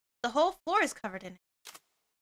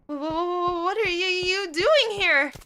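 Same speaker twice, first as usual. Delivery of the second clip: high, slow shaky panic; scared stammer; 6 semitones higher, 7 dB louder than usual. Real, not acted. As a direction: stumble over words in fright. Stammering fright at stranger.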